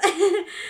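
high burst of real laughter; loud laugh. Real, not acted. Host laughing at her own nap joke, a loud high laugh.

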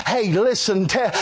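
usual speaking voice

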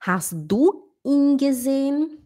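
"ihn" stretched as "eeen"; long i. Shown as a demonstration. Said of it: "por isso aqui tá o in".

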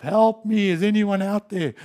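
loud, high desperate call; stuck in sinking sand, yelling for anyone to help; crying out for help. Acted. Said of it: "you're crying out for help".

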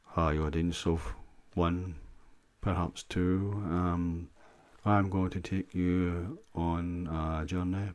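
slow, soothing hypnosis narrator; hypnotist voice. Shown as a demonstration. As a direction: draw them slowly into a trance. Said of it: "that's how I'm gonna sound".

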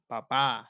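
papá, second syllable drawn out and stressed; stress on final A. Shown as a demonstration. Repeating the word for dad, papá stressed on the end. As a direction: land on the last A of papá. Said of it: "la última A tiene el acento".

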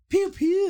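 high playful pew pew gun sounds; gunshot noises. Pretend. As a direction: make toy gun noises. Mimics gunshots.

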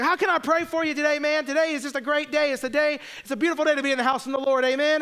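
high and fast, gushing churchy enthusiasm; overly blessed church guy. Retold or imitated. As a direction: rattle it off like a relentless holy cheerleader. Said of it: "Who talks like that?".

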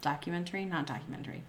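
usual speaking voice

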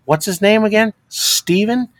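ordinary talk, nothing marked

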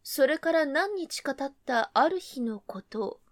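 ordinary delivery, nothing marked

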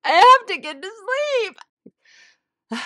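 high, loud, hammed-up tearful wail; mock crying wail. Woman retelling her sleepless night in tears, exaggerated crying wail. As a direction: wail it like an overtired crybaby. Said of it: "I just laid there crying".

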